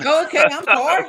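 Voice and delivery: embarrassed host laughs and apologizes after being corrected; surprised laughing apology. Real, not acted. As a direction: caught out, laugh it off with a sorry.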